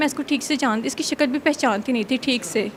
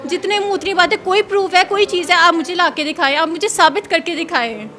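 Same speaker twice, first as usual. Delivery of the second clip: raised, insistent challenge to the interviewer; heated self-defence; 3 semitones higher, 4 dB louder than usual. Real, not acted. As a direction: argue back hard, demanding proof. Hotly defends herself against accusation. (तैश में अपनी सफ़ाई देना)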